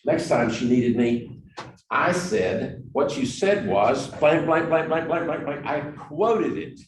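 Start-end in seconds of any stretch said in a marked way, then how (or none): 2.9-5.6 every word punched, higher and louder; mock exact quoting. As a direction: recite it back proudly, word for word. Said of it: "I quoted it with the proper emphasis".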